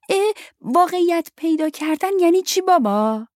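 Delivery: put-on high, loud, eager child; eager insistence. A narrator's reading. Luke begs his father for an answer, eagerly insistent. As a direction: pester Dad excitedly in a child's high voice. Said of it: "لوک مشتاقانه پافشاری کرد".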